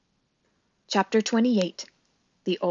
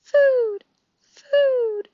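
put-on very high baby bird cry; tiny high-pitched chick voice; peeping gosling; 5 dB louder than usual. A narrator's reading. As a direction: squeak it like a hungry hatchling. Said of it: "peeped the gosling".

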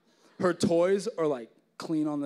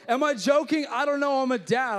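playful boast; 8 semitones higher, 7 dB louder than usual. Real, not acted. Dad joke bravado.

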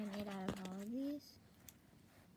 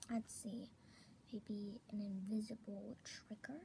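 whispering; 4 dB quieter, slower than usual. Real, not acted. ASMR whisper.

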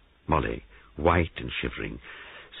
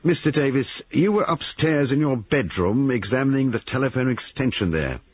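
higher, lightly voiced brisk investigator; police sergeant questioning; 10 semitones higher, 4 dB louder than usual. A narrator's reading. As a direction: check each account in a mildly official voice.

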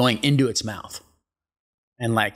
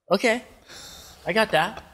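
nervous okay; 9 semitones higher than usual. Retold or imitated. Nervously agreeing underwater.

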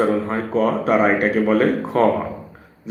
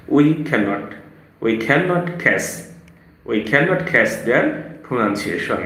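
slow English; much slower than usual. Shown as a demonstration. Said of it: "teaching in slow English".

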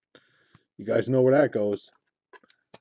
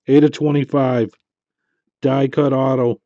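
louder, pleased call of the number; excited reveal; 7 dB louder than usual. Real, not acted. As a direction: announce the serial number proudly.

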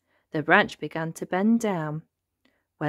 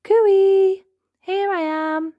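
high, ringing cooee; calling out; 13 semitones higher, 7 dB louder, much slower than usual. A narrator's reading. Hidden voice calls to searching elephants, calling out. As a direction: sing it out from a hiding place. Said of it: "It called".

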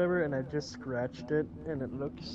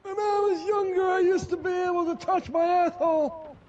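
shouting; 11 semitones higher, 5 dB louder than usual. Pretend. Shouting a silly confession.

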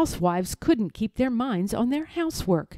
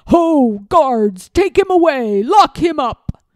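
loud, commanding villain, higher; shouted orders; 5 semitones higher, 10 dB louder than usual. Acted. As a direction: bark orders to the guards. Lord orders guards.

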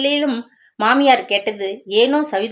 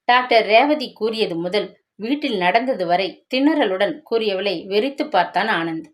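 ordinary delivery, nothing marked

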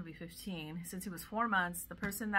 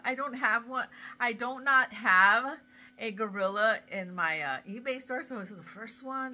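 ordinary delivery

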